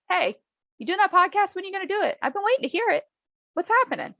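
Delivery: high-pitched, imitated nagging; pestering neighbor. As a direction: badger her with eager questions. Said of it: "she kept bothering me".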